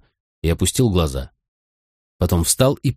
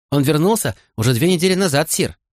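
a hint of a higher child's voice; lightly voiced little girl; 6 semitones higher than usual. A narrator's reading. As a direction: answer as an eager little pupil, pitched up. Little girl answers her elder.

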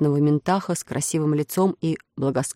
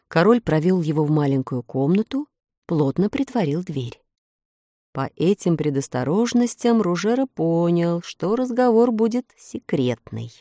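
usual speaking voice

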